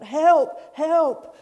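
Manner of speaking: loud, high desperate cries; shouting for help. Acted. Man hanging from a ledge, calling out loudly for help. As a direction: yell "help" up the cliff. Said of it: "he's calling out".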